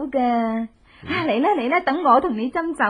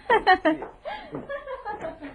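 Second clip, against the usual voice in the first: high gloating villain cackle; evil laugh; 10 semitones higher, 5 dB quieter than usual. Acted. Woman gloats over the drugged guard with an evil laugh. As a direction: cackle in triumph, soft and high.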